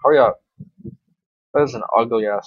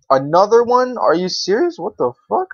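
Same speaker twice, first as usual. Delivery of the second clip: high, loud outraged disbelief; can't believe the luck; stunned disbelief; 8 semitones higher, 3 dB louder than usual. Real, not acted. Streamer reacts to another rare clan drop in loud disbelief.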